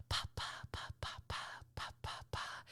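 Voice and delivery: put-on soft breathy whisper of baby syllables; whispered baby babble. Mother imitates son playing alone: whispered baby babble. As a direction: murmur like a baby lost in play. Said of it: "makes that noise while he's playing".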